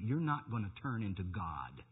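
usual speaking voice

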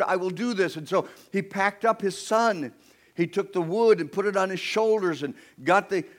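high, sustained preacher's delivery; impassioned preaching. Acted. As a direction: tell it high with urgent conviction. Preaching at full force.

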